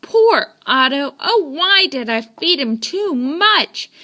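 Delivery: ordinary delivery, nothing marked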